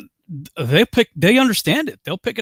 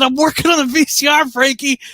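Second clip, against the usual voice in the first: higher, mildly exasperated reply; exasperated answer; 11 semitones higher, 5 dB louder than usual. Retold or imitated. Husband fixing the VCR answers the parrot, mildly exasperated. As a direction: answer the bird with mock impatience. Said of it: "I said".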